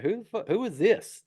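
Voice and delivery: half-serious, high "who's that?" disbelief; retold startled question. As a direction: imitate someone taken aback by a stranger. Retold startled question on finding stranger.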